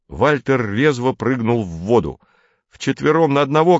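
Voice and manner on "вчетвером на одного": крик. A narrator's reading. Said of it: "крикнул он".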